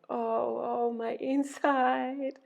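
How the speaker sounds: high, drawn-out moan. A narrator's reading. Ereshkigal moaning over her inside, long high moan. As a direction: moan the words slowly, pitch lifted. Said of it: "Ereshkigal was moaning".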